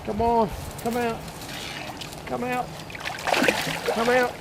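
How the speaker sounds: soft, high coaxing whisper; hushed coaxing. Real, not acted. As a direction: coax the fish out under your breath. Whispering to a hidden carp.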